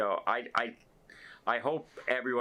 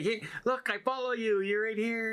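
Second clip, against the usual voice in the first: higher, proud showing off; eager crew man; 11 semitones higher than usual. Retold or imitated. Photo worker showing the vlogger his follow, eager and higher. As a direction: show the phone, eager and high.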